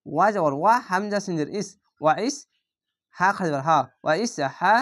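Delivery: ordinary talk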